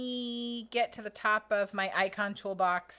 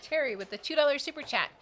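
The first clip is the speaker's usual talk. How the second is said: normal talking voice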